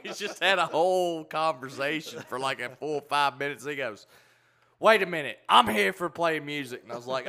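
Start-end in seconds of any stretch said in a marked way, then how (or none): 4.8-6.8 loud, high exclamation; retold protest. Retelling a musician interrupting their chat, loud protest. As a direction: break in as if suddenly remembering.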